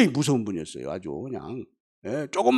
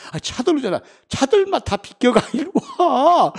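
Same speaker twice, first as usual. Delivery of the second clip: put-on drunk bravado; 12 semitones higher, 5 dB louder than usual. Drunk arguing on the road.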